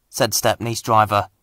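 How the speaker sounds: ordinary delivery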